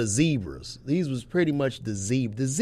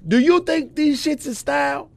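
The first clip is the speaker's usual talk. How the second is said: raised, higher, insistent voice; heated arguing; 8 semitones higher, 5 dB louder than usual. Real, not acted. Arguing with a friend over what counts as style, heated. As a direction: push back loudly, pitch climbing.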